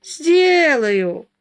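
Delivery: high, loud, long-drawn retort; defiant taunt. A narrator's reading. Wife defying husband's plea, high gloating taunt. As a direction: relish it, stretching the word out.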